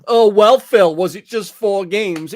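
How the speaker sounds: loud, slow mock question; sarcastic. Quoting his own jab at Phil, loud slow sarcastic question. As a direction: ham up the fake disbelief to Phil. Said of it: "It was a sarcastic comment".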